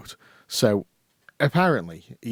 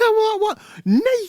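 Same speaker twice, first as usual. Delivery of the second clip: disbelieving shriek; 7 dB louder, slower than usual. Retold or imitated. Retold disbelief at friend.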